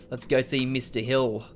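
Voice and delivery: ordinary delivery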